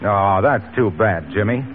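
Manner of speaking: ordinary talk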